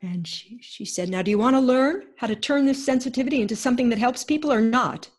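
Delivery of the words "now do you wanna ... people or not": loud, fast, higher demanding challenge; imitating the fierce healer, fast and loud. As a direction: push her hard, no patience. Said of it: "This is how she talked to me".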